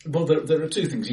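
usual speaking voice

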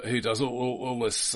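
usual speaking voice

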